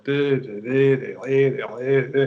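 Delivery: drawled nonsense syllables mimicking a rapper's accent; imitated rapper's drawl. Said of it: "the drawl of his accent".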